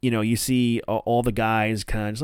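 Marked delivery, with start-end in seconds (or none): none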